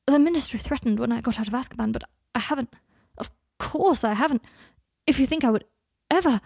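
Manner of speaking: loud, rising desperation; distressed, emphatic outburst; agitated protest. Acted. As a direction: plead your case, pitch climbing to the last word. Panicked quarrel over custody.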